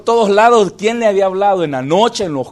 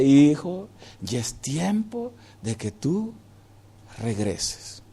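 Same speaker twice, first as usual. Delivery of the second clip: tender voice of God; 3 semitones lower, 6 dB quieter, much slower than usual. Acted. God speaking to the heart.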